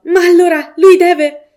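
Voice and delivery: high, loud, slow alarmed outburst; the mother realising a stranger had the car, crying out high; horrified cry. A narrator's reading. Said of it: "La signora Reeves gridò".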